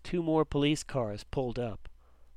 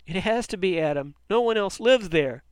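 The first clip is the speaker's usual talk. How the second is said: panicked; 6 semitones higher, 7 dB louder than usual. A narrator's reading. Panicked boy insists to father.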